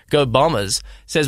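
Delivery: ordinary talk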